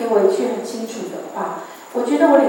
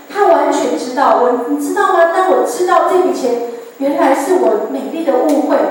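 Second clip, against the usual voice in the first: louder, impassioned preacher's delivery; fervent preaching; 4 semitones higher, 5 dB louder than usual. Acted. Preacher exhorting brothers and sisters, fervent and loud. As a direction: drive the point home to the congregation. Preaching testimony at full force.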